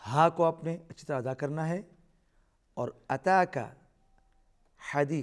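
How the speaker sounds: ordinary delivery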